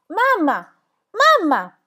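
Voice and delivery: put-on girl shouting to wake her mother; frantic shout. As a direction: try to shout her awake. Trying to wake mother on floor.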